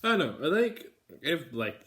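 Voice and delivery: high, loud "I don't know"; exasperated yell. Real, not acted. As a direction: burst out in mock agony. Loud indecision.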